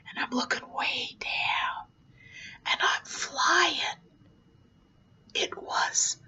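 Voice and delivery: soft whispered wonder; hushed awe. Retold or imitated. Clown high above the island, whispered awe. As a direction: whisper as if peering far down from the clouds. Looking down while flying.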